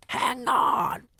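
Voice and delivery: high, loud witch cry. Acted. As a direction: cry out to stop everyone. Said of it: "cried the witch called Mildred".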